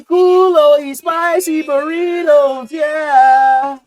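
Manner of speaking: high, slow, loud sing-song mock Spanish; mock Spanish accent. Friend hamming up a Univision star, slow and sung.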